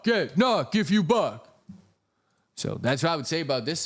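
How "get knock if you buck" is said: high, slow put-on voice, knock stretched; mock catchphrase. Streamer repeating his catchphrase in a silly voice. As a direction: say it again in the silly voice.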